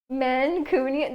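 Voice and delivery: Mancunian said slowly, syllable by syllable; puzzled, she tries out Mancunian slowly; sounding it out. Shown as a demonstration. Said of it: "What is that?".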